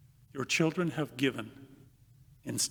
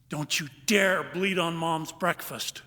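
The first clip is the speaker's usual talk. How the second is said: put-on slow, emphatic child's warning, hard on "dare"; scolding warning; 3 semitones higher than usual. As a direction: warn a sibling firmly and slowly. Child scolding another in a story.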